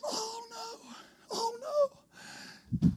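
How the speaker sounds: tiny, high, very soft whimper; acted small dismayed wail. Boyfriend watching his girlfriend leave, acted faint dismayed whimper.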